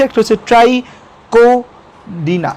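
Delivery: ট্রাইকোডিনা split into slow syllables. Shown as a demonstration. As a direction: say ট্রাইকোডিনা slowly, part by part. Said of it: "কীভাবে উচ্চারণ করি সেটা আমি তোমাদের দেখাই". (ট্রাইকোডিনা ধীরে ধীরে ভাগ করে উচ্চারণ)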